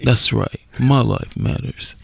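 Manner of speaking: low, deep mocking voice; impression of Brittany Griner. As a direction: drop the voice deep and mock her. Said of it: "be Brittany for a minute".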